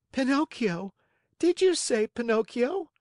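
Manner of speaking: put-on high, excited child voice; eager little marionette; startled puppet boy. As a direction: pipe up in high-pitched surprise. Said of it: "replied the Marionette, jumping to his feet".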